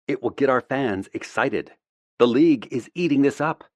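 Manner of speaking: brisk PR woman. A narrator's reading. Ava argues, higher and louder.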